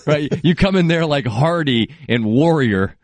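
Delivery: ordinary delivery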